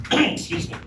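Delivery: normal talking voice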